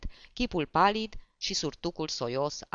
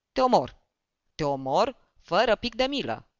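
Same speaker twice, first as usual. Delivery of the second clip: loud, higher furious threat; death threat; 4 semitones higher, 5 dB louder than usual. A narrator's reading. Barber threatens to kill the drunk comic, furious. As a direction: spit out the threat with full force.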